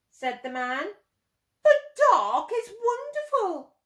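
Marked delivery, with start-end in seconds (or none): 1.6-3.8 high, loud and delighted; enthusiastic. Acted. Man with telescope, delighted by the dark. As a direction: sell the wonder of the dark.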